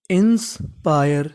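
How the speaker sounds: Inspire drawn out slowly, syllable by syllable; slow sounding out. Shown as a demonstration. Teacher to students slowly sounding out inspire. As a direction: blend inspire slowly for beginners. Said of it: "तो meaning बन गई".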